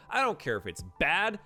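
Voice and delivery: ordinary delivery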